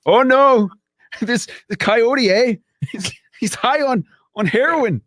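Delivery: put-on high, excited Canadian accent with eh. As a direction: do a hammed-up Canadian, eh. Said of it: "my Canadian accent".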